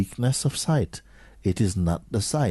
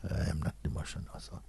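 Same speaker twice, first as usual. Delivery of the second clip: quick whisper; 4 semitones lower, 13 dB quieter, faster than usual. Shown as a demonstration. Teacher denying identity with emotion.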